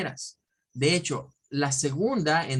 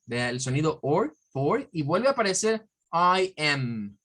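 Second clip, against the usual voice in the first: usual speaking voice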